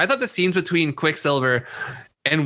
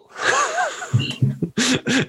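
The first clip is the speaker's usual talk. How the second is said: 1 high squealing laugh. Real, not acted. Laughing at own impression.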